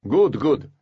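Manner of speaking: warden voice. A narrator's reading. The warden speaks, relieved.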